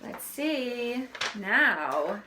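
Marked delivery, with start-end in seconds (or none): none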